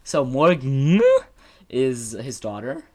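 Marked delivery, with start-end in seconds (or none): 0.2-1.0 "Morgan" loud, ending on a long rising n; "Morgan" hammed up with a drawn-out hummed ending; exaggerated pronunciation. Shown as a demonstration. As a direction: overdo "Morgan" in playful answer to a correction. Exaggerates the corrected name.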